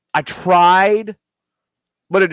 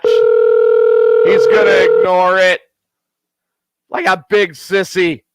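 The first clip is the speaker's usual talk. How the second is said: louder, slow, each word dragged out; slow, knowing drawl; 4 semitones higher, 4 dB louder, slower than usual. Real, not acted. Predicting to listeners the friend dodges the call, slow louder drawl. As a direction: stretch it out like a told-you-so.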